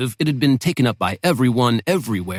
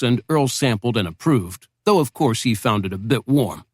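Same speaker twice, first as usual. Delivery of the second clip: plain reading voice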